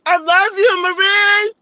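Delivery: high, loud declaration of love; excited calling out. Real, not acted. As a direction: holler it out to a dear friend. Calling affectionately to viewer Marie.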